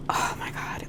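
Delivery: breathy whispered oh my God. Real, not acted. Vlogger to viewers, whispering through a migraine. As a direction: whisper it in pain.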